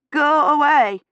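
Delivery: shaky cry of go away through chattering teeth; frightened, chattering teeth. A narrator's reading. Feverish girl begs the stranger to leave, high and afraid. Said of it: "her teeth chattering so hard".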